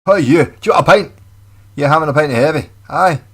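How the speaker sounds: loud, high Scottish pub voice; Scottish man offering a pint, loud and gruff. Retold or imitated. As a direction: bark it like a Glasgow local offering a pint. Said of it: "sounds much more aggressive in Scottish".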